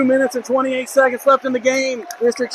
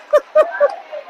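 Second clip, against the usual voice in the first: short high-pitched laugh; real amused laugh at the player. Real, not acted. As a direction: burst out laughing at the flop. Laughing at player begging foul.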